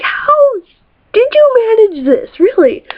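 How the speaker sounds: high, astonished question; amazed disbelief. Retold or imitated. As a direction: squeal it in disbelief. Amazed at boyfriend's trick.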